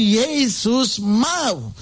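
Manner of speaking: Yesus mau stretched very slow; a slow, solemn promise to the listeners; drawn-out proclamation. Acted. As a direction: hold every syllable of Yesus mau. Climax of the sermon appeal.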